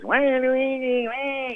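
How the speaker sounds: high, slow, nasal-free sneering "wah"; Waluigi voice. Pretend. In the studio, playing Waluigi, high and drawn out. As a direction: stretch it out like a gleeful villain. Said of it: "I never know who exactly I'm gonna play".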